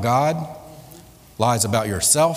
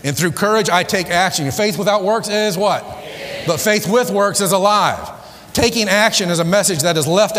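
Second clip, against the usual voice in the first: preaching at full force; 5 semitones higher than usual. Acted. Preaching climax to congregation.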